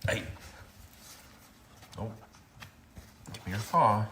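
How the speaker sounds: usual speaking voice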